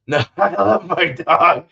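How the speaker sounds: usual speaking voice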